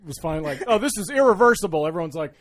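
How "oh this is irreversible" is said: mock quote. Mock Supreme Court quote.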